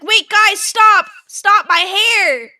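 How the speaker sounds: high, loud yell, slowing on the last words; panicked yell. Retold or imitated. Hair caught in the paddle wheel, yelling at friends to stop. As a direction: shout for them to stop the wheel. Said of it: "I thought that I, like, was yelling".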